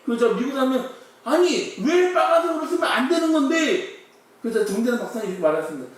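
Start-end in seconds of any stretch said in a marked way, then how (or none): 1.2-4.0 puzzled protest. Acted. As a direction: push back in disbelief. (높고 크게 어리둥절한 반문)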